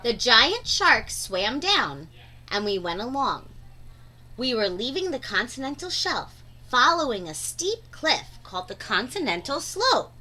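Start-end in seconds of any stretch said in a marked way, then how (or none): none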